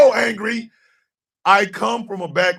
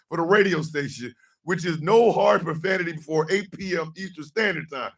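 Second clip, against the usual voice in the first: ordinary delivery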